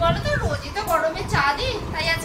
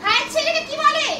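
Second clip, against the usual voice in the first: scolding teacher; 7 semitones higher than usual. Acted.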